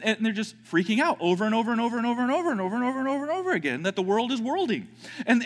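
ordinary talk

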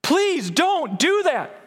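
high-pitched, pleading cry to God. Acted. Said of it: "he's crying out".

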